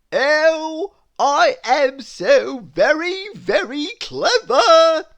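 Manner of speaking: high, loud, slow smug boast; a very pleased little man crowing about himself; boastful Mister Clever. Acted. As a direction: puff yourself up, drawing out every word.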